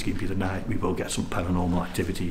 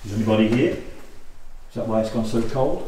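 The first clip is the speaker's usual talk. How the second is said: calling out; 3 semitones higher than usual. Real, not acted. Calling out to spirits.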